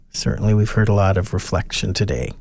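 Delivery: normal talking voice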